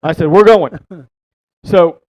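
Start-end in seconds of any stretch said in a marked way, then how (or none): 0.3-0.8 urgent shout. Retold or imitated. Ordering old partner off lake.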